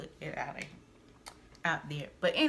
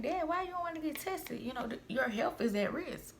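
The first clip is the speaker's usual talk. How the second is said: much higher, imitating her own plea; pleading with dad; 9 semitones higher than usual. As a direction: pitch up as she begs dad. Quoting herself to dad.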